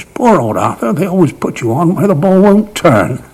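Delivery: high, squeaky little mock-pitying voice. Batsman teasing a beaten bowler, squeaky voice. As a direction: put on a funny squeak, swooping up high. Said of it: "in that funny little squeaky voice of his".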